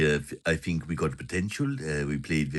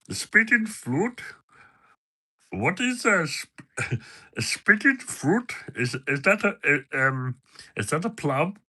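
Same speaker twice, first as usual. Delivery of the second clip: higher mock voice of a puzzled mate; Patter's voice; 9 semitones higher than usual. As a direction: play the confused friend, pitched up. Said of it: "in Patter's voice".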